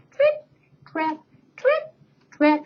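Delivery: light, high tiny hoof steps; teeny little trip trap. Acted. Teacher voicing the smallest goat's hooves, high and light. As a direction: tap out tiny goat steps. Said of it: "he made a teeny tiny bridge, little noise like this".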